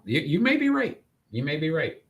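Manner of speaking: ordinary talk, nothing marked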